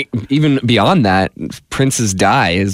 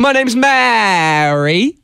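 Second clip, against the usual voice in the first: mock British accent; 8 semitones higher, much slower than usual. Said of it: "one has a British accent".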